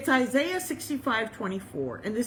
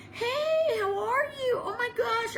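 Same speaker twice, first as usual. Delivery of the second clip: high, singsong delighted hello; acted-out excited hello; gushing greeting; 12 semitones higher than usual. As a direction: greet an old friend in a high, drawn-out gush. Acts out friend suddenly greeting.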